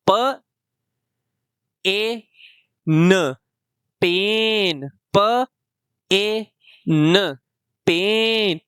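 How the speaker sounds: प, ए, न blended into पेन with a long ए; long a blending. Shown as a demonstration. Teacher shows magic e changing पैन into पेन. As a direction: sound it out slowly, then blend to पेन. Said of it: "ई तो silent हो जाएगा".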